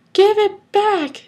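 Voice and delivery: high, loud, drawn-out yell. A narrator's reading. Bullied child to kids tossing his lunch, high drawn-out yell. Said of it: "Ciel yelled".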